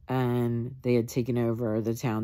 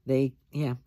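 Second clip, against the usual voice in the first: usual speaking voice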